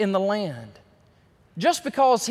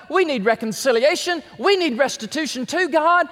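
loud, higher preaching at full force; fiery preaching; 7 semitones higher, 4 dB louder than usual. Acted. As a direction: drive the point home to the congregation.

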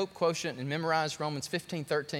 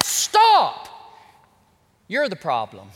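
God's stern word; 9 dB louder, slower than usual. Acted. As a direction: stop him cold as God. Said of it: "And God said".

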